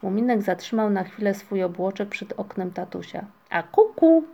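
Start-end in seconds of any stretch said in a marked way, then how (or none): 3.5-4.2 loud, high peekaboo call. A narrator's reading. Muminek at father's window, calling out excitedly. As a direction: sing out to surprise someone. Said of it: "zawołał".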